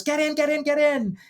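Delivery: high, urgent yell at everyday volume; mock yell. Husband yelling his wife into the shelter, high mock yell. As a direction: imitate a frantic man yelling orders. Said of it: "And he yells".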